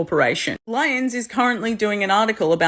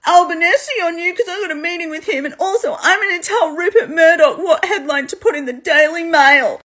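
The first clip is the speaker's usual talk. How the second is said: high, whiny mocking Trump voice, a laugh in it; lifted, whiny Trump parody; mock Trump whine; 9 semitones higher than usual. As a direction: sneer it like a petty tattletale. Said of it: "Trump was like".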